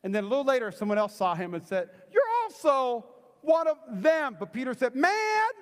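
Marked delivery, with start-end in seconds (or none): 2.1-4.4 slow, high, loud finger-pointing; acted accusation. Bystander accuses Peter by the fire, loud drawn-out accusation. 5.0-5.5 high, loud comic protest; indignant denial. Acted. Peter denies being one of them, loud hammed-up indignation.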